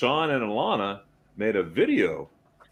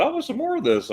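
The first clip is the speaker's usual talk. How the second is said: excited. Retold or imitated. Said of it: "I said".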